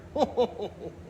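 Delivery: jolly ho-ho laugh, high and airy; a jolly Santa chuckle. A narrator's reading. Santa laughing with his team, jolly.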